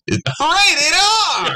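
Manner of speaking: high, excited mock announcer; movie trailer voice. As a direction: squeal the rating like a trailer. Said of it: "the trailer voice guy".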